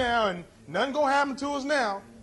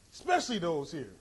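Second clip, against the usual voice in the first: soft repeat; 6 semitones lower, 5 dB quieter than usual. Shown as a demonstration. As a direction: lower and softer, "Especially those here" said as an aside. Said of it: "repeated softer".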